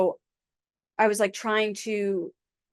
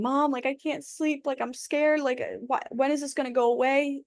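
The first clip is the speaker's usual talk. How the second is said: higher, small frightened voice pleading with mom; scared crying plea; 6 semitones higher than usual. Retold or imitated. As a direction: replay it like a terrified child begging mom for help. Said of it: "crying and, like, saying".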